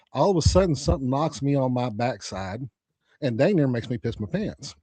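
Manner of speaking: normal talking voice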